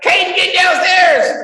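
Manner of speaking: high, loud mock yell downstairs; hammed-up mom shout, drawn out; wife yelling. Wife yelling up at son to come downstairs, mock high shout. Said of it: "wife is like".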